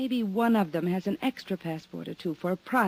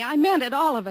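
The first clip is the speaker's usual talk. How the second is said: pleading and raised, higher and louder; earnest plea; 7 semitones higher, 5 dB louder than usual. Acted. Gloria pleading with Johnny, raised and earnest. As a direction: insist she truly meant it. Gloria insists she was sincere.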